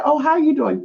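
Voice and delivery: high small-talk greeting; playing it off. Small talk to a troublesome neighbour, playing it off. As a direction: greet them high, as if nothing is wrong. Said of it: "you play it off".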